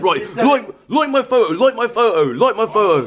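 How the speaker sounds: high, far put-on voice begging; mocking his mate. Teasing his mate about Instagram, high mock begging voice. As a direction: squeak out a mock plea for likes. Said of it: "He's like".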